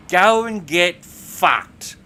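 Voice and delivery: slow, loud, every word spaced out; acted fury, weighting each word heavily; angry retort. Colleague's angry parting retort.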